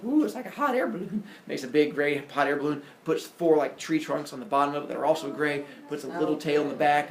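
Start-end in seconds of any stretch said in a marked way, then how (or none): 0.0-1.2 high, put-on awed voice; childlike wonder. Epimetheus playing with gray Play-Doh, wondering. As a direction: coo it high like a kid.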